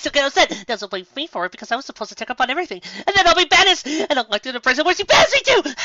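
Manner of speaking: high, loud, rushing panic; panicked outburst. Acted. Twilight panicking at the baker over a mistake, high frantic shouting. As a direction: spiral into a frantic meltdown. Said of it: "Twilight started hyperventilating".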